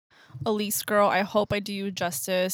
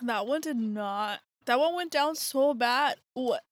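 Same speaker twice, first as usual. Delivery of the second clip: ordinary delivery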